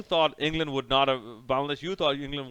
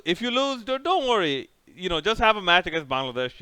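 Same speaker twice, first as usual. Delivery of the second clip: high, loud fake comfort; mock reassuring; 6 semitones higher, 5 dB louder than usual. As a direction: sarcastically soothe a loser.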